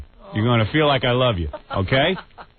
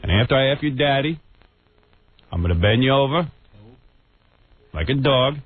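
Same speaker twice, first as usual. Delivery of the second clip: usual speaking voice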